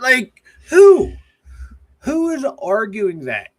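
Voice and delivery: high, loud disbelieving who; who would even claim that, shout it; incredulous outburst. Real, not acted. Incredulous at the Bradshaw take.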